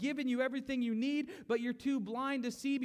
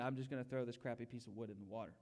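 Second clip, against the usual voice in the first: soft, lower, fast throwaway line; dismissive mutter; 11 semitones lower, 10 dB quieter, faster than usual. Retold or imitated. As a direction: toss it off under the breath. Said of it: "Moses did not have the idea".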